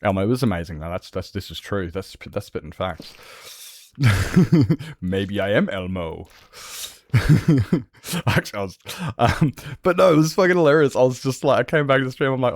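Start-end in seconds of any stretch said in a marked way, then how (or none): 4.0-4.9 high, loud giggle; amused laugh. Real, not acted. Amused by own Elmo joke. 7.1-7.8 short high, loud giggle; amused laugh. Real, not acted. Amused by own Elmo joke.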